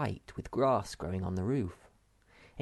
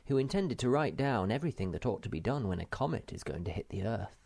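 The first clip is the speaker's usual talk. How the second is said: ordinary delivery, nothing marked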